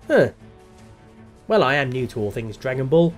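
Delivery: ordinary delivery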